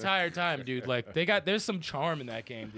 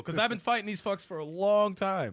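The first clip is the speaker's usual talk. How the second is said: normal talking voice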